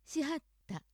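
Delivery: しはった in Osaka accent, pitch held up; 大阪アクセントのしはった. Shown as a demonstration. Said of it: "大阪アクセント、".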